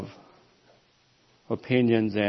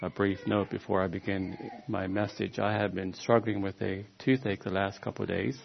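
ordinary delivery

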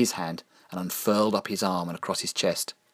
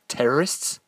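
high, rising desperate question; desperate suggestion; 6 semitones higher than usual. A narrator's reading. Belinda offers Michael an excuse, desperate questioning. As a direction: ask it desperately, pitch climbing. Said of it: "suggested Belinda desperately".

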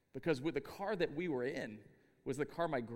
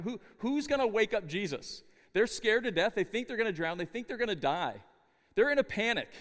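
higher and louder, building into panic; frantic, pitched-up retelling; rising panic; 5 semitones higher, 6 dB louder than usual. Acted. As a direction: sell the disciples' terror with urgency. Preacher builds the panic scene.

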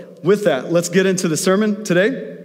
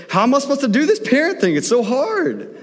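higher, whiny complaint about parenting; exasperated wife; 9 semitones higher than usual. Retold or imitated. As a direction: voice the worn-out mom, pitch jumped up. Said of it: "She was like".

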